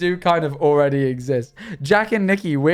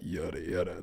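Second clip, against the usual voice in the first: much lower, much softer; deep Japanese-style voice; 8 semitones lower, 14 dB quieter than usual. Shown as a demonstration. Streamer demos how he lowers his voice. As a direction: drop into a low murmur like Jotaro. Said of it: "that's the way I learned to lower my voice".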